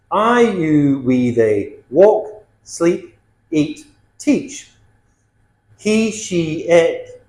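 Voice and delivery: ordinary delivery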